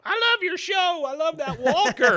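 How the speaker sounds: high, loud fangirl scream; shrieking with adoration; fan screaming. Retold or imitated. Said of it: "just screaming at him".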